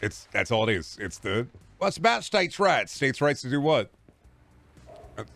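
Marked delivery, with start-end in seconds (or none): 1.8-2.9 mock Southern accent. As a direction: imitate a Southerner making excuses. Mocks a Southern flag defender.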